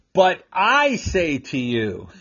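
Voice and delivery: slow, with "I" stretched high; deliberate proclamation, weight on "I"; quoting Jesus preaching. Acted. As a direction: lean long and high on "I". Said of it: "guess what he says?".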